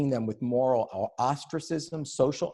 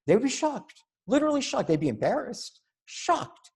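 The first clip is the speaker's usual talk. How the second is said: much higher, louder cry of shock; shocked emphasis; 12 semitones higher, 4 dB louder than usual. Real, not acted. Speaker mocks campus culture, voice leaping up in exaggerated shock. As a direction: pitch it up high like scandalised founders. Founding fathers' outrage, mock-dramatic.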